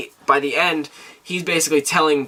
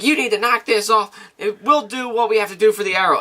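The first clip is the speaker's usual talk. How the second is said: higher-pitched voice for a retold mayor; lightly voiced mayor; 6 semitones higher than usual. Mayor breaking up a fight, lightly voiced official. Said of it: "then the mayor's like".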